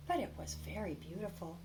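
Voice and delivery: ordinary delivery, nothing marked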